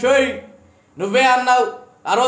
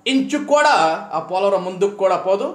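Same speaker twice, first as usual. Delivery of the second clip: ordinary talk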